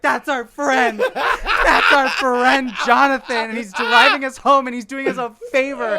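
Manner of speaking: high, loud, tearful wail, half laughing; crying protest. Retold or imitated. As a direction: plead through mock sobs. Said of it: "Julia starts to cry, and she's like".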